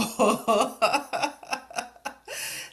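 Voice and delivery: bright burst of giggling; a knowing giggle. Real, not acted. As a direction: laugh before the reveal. Laughs at the upcoming plot point.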